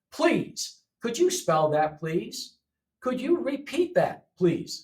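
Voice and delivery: "please" set first and last, each said clearly; please at the end. Shown as a demonstration. Teacher modelling polite requests with please. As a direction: lean on "please?" as the closing word. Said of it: "See, the please is at the end".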